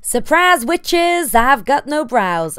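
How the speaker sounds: spring the surprise on the viewers; playful reveal. Acted.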